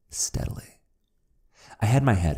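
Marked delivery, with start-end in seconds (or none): 0.1-0.8 a breathy whispered repeat; whispered echo. A narrator's reading. Repeated word, echoed.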